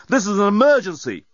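loud, high protest; exclaiming. A narrator's reading. Dan protesting to the doorbot, exclaiming. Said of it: "exclaimed Dan".

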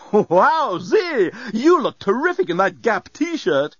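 high, gushing bellbot; exclaiming robot. A narrator's reading. Bellbot admires passenger's t-shirt, exclaiming. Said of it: "it exclaimed".

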